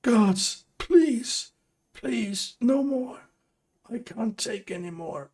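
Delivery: high, desperate, sobbing begging; sobbing plea. Acted. Bound prisoner begging a stranger, sobbing high. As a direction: beg for mercy through sobs, pitched high. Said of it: "cringes and sobs".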